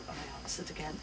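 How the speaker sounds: ordinary delivery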